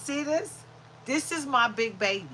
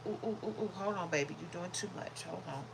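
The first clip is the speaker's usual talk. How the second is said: ordinary talk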